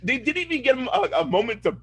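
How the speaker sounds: ordinary talk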